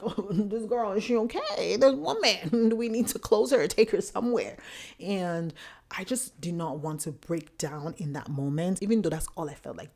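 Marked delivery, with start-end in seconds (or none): 0.0-4.6 higher, louder mock-alarmed bystanders; hammed-up concern in a raised voice; concerned onlookers. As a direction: imitate strangers fussing over someone, half laughing. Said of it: "these people are like".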